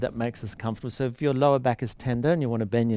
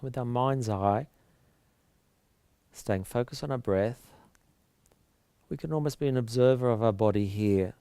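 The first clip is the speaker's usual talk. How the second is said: calm breathing-count voice with long pauses; soft, measured yoga meditation delivery; guided relaxation; 3 dB quieter than usual. Real, not acted.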